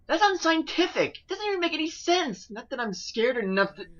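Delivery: high, nervous protest; defensive boy. Acted.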